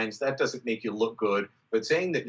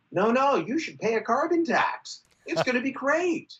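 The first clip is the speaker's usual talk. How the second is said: high, mocking sarcastic tone; mock the MPs' sales pitch, pitched up; 11 semitones higher than usual. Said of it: "gonna have to go around saying".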